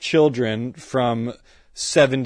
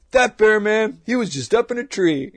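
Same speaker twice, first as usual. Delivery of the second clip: imitating the neighbour; 9 semitones higher, 4 dB louder than usual. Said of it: "And he goes".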